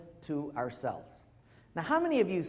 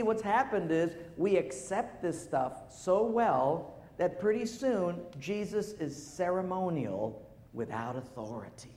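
ordinary talk

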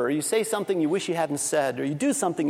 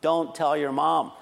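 slow, deliberate cover-up, lower pitch; conspiratorial dad; 4 semitones lower, slower than usual. Retold or imitated. Father to daughter after the slide mishap, mock conspiratorial. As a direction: swear the child to secrecy, lower and slow. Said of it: "what every father says at a time like this".

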